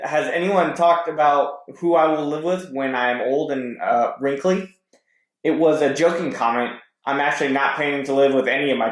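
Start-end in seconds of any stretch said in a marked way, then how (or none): none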